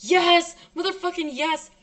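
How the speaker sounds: loud, high triumphant yes; exultant cry. Real, not acted. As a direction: let out a burst of fierce joy. Exultant outburst after defiant speech.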